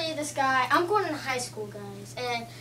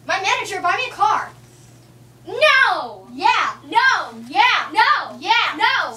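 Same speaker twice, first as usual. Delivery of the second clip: bratty diva demanding a car; whiny, insistent diva tantrum; 4 semitones higher, 5 dB louder, slower than usual. Acted. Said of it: "an impression of a diva who wants a car".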